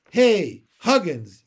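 loud schoolboy call; sharp shout, starting high; yelling. A narrator's reading. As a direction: holler to a friend across the playground. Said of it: "yelled the boy".

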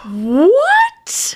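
high, loud cry of disbelief; real shock, pitch leaping up; astonished cry. Real, not acted. Co-host hears the actor's daughter's name, astonished cry. Stunned by the revelation.

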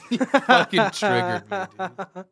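loud, high burst through laughter; laughing. Real, not acted. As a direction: squeal it out while cracking up. Laughing outburst at a line.

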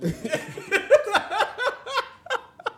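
long high giggle fit; high laugh. Real, not acted. Cracks up at own story.

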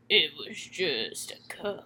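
low, soft gnarly growl; lightly growled ghostly voice; growly and gnarly. Retold or imitated. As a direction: croak it like a dead man's tape. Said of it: "it's so growly and gnarly".

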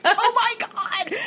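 high, drawn-out squeal through laughter; mock shock. Investigators hear a clear swear, laughing mock shock. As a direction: squeal it slowly, imitating their astonishment.

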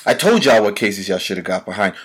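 ordinary delivery

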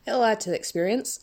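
ordinary talk, nothing marked

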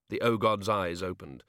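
ordinary talk

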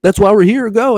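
very high, loud yelling push; urgent yell. Retold or imitated. Wife yelling at her husband to get in the ring, very high and loud. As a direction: yell at him to go.

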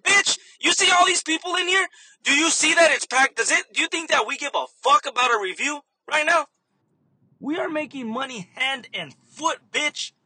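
high-pitched imagined telling-off; hammed-up indignant retort; mock tirade. Cashier imagines telling off customer about reviews, mock tirade.

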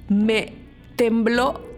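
usual speaking voice

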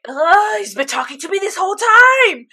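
high, loud wail of realization; exasperated wail. Retold or imitated. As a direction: cry out in mock breakdown. Acting out own shocked realization, breakdown.

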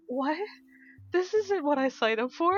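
a put-upon whine at the steep hill; indignant disbelief. Retold or imitated. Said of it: "And I was like".

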